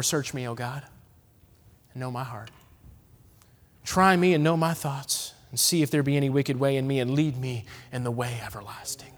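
hushed, reverent reading of a psalm; gentle reverent recitation; soft scripture reading. Acted. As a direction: read the verse low and prayerful, fading to a whisper. Reads psalm softly.

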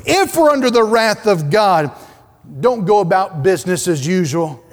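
loud, high, driving preacher's cry; fiery preaching. Acted. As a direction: preach it out with full force to the congregation. Preaching at full force.

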